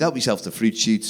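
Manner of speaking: low, soft throwaway offer; nonchalant. Retold or imitated. Parent to toddlers at a party, nonchalant. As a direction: toss it off casually, low and soft. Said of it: "Just a nonchalant comment".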